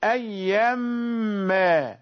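أيا ما stretched very slowly then stopped. Shown as a demonstration. Teacher demonstrating the stop for a student asked by the sheikh. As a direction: drag out أيا ما and halt. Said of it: "تقف عليها".

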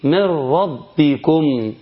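"من ربكم" slow, noon vanished into a doubled ra; Quranic idgham. Shown as a demonstration. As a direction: recite slowly, swallowing the noon into the ra. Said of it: "وأنا بقرأها في التلاوة". (إدغام النون في الراء بتلاوة بطيئة)

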